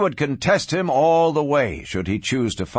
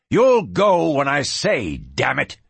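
angry command; 3 semitones higher than usual. Acted. Rancher forbids the gunman to leave, angry command. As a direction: lay down the law angrily. Rancher angrily orders him.

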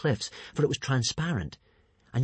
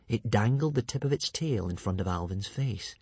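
usual speaking voice

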